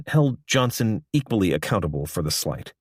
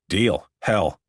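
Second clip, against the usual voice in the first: snorting. A narrator's reading. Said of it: "Horrigan snorted".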